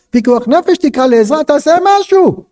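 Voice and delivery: urging, climbing high to a cry; urgent appeal. Acted. Teacher urging husband to save her, urgent. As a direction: build from firm to high, desperate urging.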